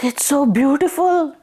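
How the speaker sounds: high, far-off marvelling voice; delighted sigh. Acted. Parvati admiring the cow and calf, high and delighted. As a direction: swoon over the sight. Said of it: "She's like".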